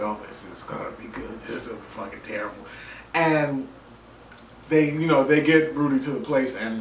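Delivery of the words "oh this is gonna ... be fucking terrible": lower, much softer aside, last line fast; quiet gloating aside; gleeful hush. Retold or imitated. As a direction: murmur it like a secret delight. Anticipating a trainwreck, quoted.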